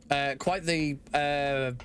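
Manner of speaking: usual speaking voice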